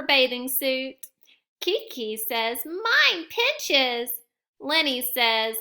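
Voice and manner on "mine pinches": put-on complaining child. Child complains about swimsuit.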